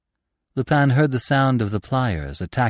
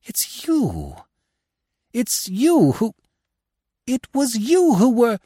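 rising higher and louder in disbelief; stammering shock; 13 semitones higher, 4 dB louder, slower than usual. A narrator's reading. Lupin realizing who she is, rising stammer. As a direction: build from stunned to overwhelmed. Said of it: "he stammered".

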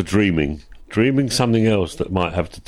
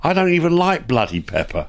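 ordinary talk, nothing marked

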